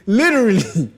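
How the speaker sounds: high, loud words through laughter; laughing. Real, not acted. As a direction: crack up while pleading. Laughing while retelling desperate plea.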